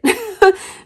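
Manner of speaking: a quick, high, loud laugh; real laugh, then straight back to talk; bright burst of laughter. Real, not acted. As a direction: laugh out at your own push. Reacting to her own advice.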